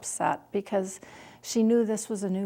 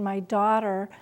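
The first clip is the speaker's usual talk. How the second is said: normal talking voice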